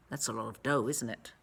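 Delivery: usual speaking voice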